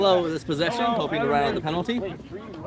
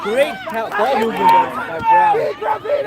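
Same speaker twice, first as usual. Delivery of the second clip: excited commentary; 6 dB louder, slower than usual. Real, not acted. Commentator excited after goal.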